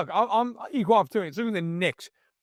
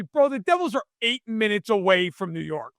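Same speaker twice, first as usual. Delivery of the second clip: ordinary delivery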